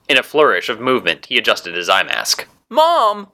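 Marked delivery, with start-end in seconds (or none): 2.7-3.3 put-on very high-pitched child's cry for mom; little girl calling. A narrator's reading. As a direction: call out high as a small girl. Little girl cries for her mother.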